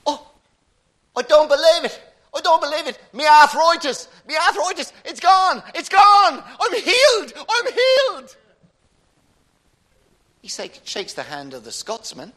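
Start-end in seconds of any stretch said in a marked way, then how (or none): 0.1-8.4 very high, loud, ecstatic Irishman; hammed-up Irish miracle shrieking; Irish accent. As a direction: cry out each phrase in amazement, broad Irish. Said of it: "and the Irishman says".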